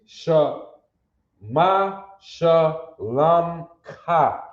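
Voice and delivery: "Sha, ma, shalom ka" split up, with "shalom" stretched very slowly; drawn-out pronunciation demo. Shown as a demonstration. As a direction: spell out "shalom ka" syllable by syllable, drawing it out. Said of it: "You got that?".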